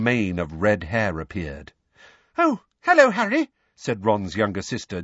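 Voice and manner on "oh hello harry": much higher, louder cheerful hello; light up at seeing him; bright greeting. A narrator's reading. Ginny greeting Harry at the door, bright and cheerful. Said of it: "Ginny, brightly".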